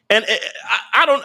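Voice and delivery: ordinary talk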